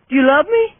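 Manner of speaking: squeaky pet talk. Real, not acted. Same question, much higher.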